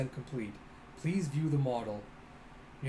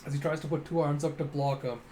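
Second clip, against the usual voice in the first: usual speaking voice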